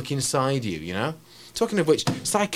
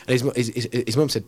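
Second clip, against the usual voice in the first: ordinary delivery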